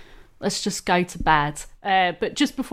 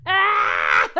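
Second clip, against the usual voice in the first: mock pained cry; 9 semitones higher than usual. Telling how Linda's foot hit the spike, mock pained shriek. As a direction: yelp as if your foot was just speared. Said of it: "go from happiness to".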